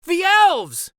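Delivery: high, loud excited shout; a child spots the elves and shouts. Acted. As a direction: yell out to point at something. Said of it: "shouted one of the children".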